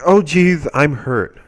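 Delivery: high, loud start, then dropping; mock hurt wail. Billy hurt by the football, mock wail. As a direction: imitate a hurt kid's complaint. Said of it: "Billy's obviously hurt".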